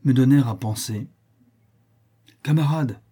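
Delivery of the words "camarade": raised, urgent call; sharp hushed alarm call; cried out. A narrator's reading. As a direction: call out to your comrade. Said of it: "m'écriai-je".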